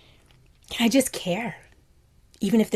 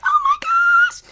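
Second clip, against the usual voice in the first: very high, louder gush of delight; overjoyed; 8 dB louder, slower than usual. Retold or imitated. Nana thrilled by her niece's greeting, squealing very high. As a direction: gasp it out, overwhelmed with joy. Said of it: "I'm like".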